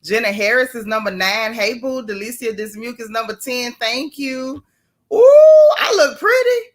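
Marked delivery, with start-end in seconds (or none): 5.1-6.7 loud, high, squealing ooh; delighted squeal. Real, not acted. Host admiring herself on camera, loud high squeal.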